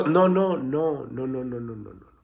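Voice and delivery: rapid run of noes fading away; dismissive no-no. Real, not acted. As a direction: wave it off, voice sinking to almost nothing. Cuts off his imitated testimony.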